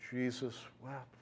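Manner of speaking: soft, reverent near-hush; hushed awe. Real, not acted. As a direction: let it fall almost silent. Preacher dwelling on Jesus' grief.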